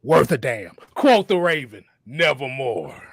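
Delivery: brooding wrestler sign-off with dramatic pauses; Raven. Acted. Host capping his wrestler impression for co-hosts, measured and theatrical. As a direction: deliver it as a grave, theatrical catchphrase. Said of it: "let me do my best Raven impression".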